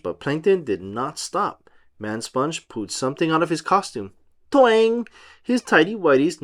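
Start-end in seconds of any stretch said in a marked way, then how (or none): none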